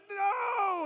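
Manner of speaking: high loud cry of no; shouted no of an old man in pain; pained refusal. Retold or imitated. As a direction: yelp a refusal through the pain.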